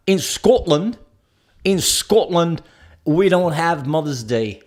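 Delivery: slow, mock Scottish accent drifting towards Spanish; botched Scottish accent. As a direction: imitate a Scottish coworker, badly. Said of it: "he sounded kind of Spanish".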